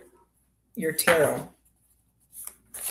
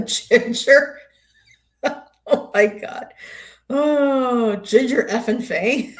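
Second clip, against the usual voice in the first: laughing, high and loud as the name comes out; amused; 8 semitones higher, 5 dB louder, slower than usual. Real, not acted. Streamer recalls last night's show with a viewer, amused. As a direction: crack up over the memory, pitch jumping up.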